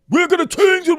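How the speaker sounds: high, loud mock rally voice; hammed-up stump-speech promise; mock pompous leader. Mocking a politician's empty promise, high and loud.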